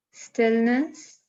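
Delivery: ordinary talk, nothing marked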